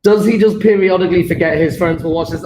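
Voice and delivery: ordinary delivery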